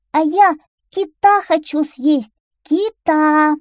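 high, loud little duckling shouting; крик утёнка. Acted. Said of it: "крикнул маленький утёнок". (громкий высокий крик утёнка)

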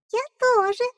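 chick voice. Acted. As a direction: squeak it like a newborn chick. Chick echoes.

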